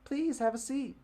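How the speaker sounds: put-on high, loud little-girl voice; girls' voice. Girl inviting guests to sit, high child voice. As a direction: play the tiny hostess. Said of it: "I'm giving them all the same voice".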